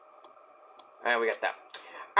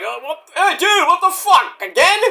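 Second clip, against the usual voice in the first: higher, indignant disbelief; mock outrage; 11 semitones higher than usual. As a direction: play it like an offended wrestler. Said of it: "And Dax is like".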